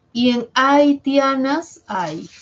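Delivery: reading the word out for the class; slow emphatic example. Shown as a demonstration.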